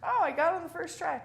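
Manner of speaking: schnell und hoch, gespielt stolz; a quick, high, self-mocking boast; mock proud. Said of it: "I was like".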